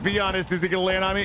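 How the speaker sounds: ordinary delivery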